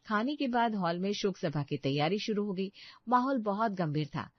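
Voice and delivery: plain reading voice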